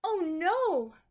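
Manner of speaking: loud, high dismayed gasp of words; alarmed at the wilted flowers. Acted. Lucy sees her drooping blooms, loud dismayed cry.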